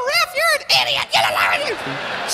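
very high comic heckler's voice, slowing at the end; disguised voice. Acted. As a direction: yell at the referee in a put-on voice. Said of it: "Change your voice".

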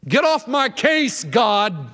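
high-pitched, testy brush-off; defiant. Acted. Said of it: "in living color defiance and rebellion".

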